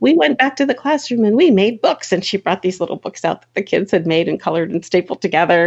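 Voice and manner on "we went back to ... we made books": higher-pitched imitation of an eager teacher; excited teacher. Teacher shows visiting author the children's work, excited. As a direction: share the news eagerly in a raised voice. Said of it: "came running out".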